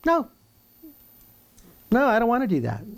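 high, loud stubborn no; defiant refusal. Acted. Preacher voicing sinners refusing God, defiant and loud. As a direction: shout the refusal like a rebel.

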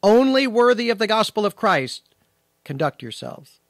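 forceful stress on Only worthy; emphatic Greek word order. Shown as a demonstration. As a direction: proclaim Only worthy of the gospel of Christ as the powerful point, then let conduct yourselves fall away. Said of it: "So the emphasis is on worthy".

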